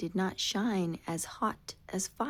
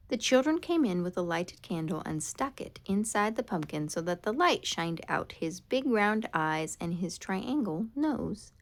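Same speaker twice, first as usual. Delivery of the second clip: usual speaking voice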